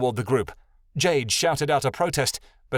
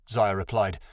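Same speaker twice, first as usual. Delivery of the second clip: ordinary delivery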